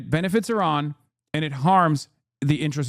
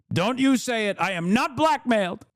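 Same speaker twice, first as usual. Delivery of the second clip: higher-pitched, hammed-up indignation; mock indignant protest; 6 semitones higher than usual. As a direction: imitate a touchy man protesting, pitched up. Mocking Trump's protests in his voice.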